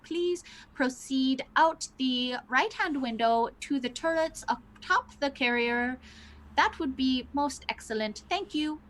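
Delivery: put-on like a crackly old phonograph announcement; tinny voice. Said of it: "tinny sounding voice, almost like an old phonograph".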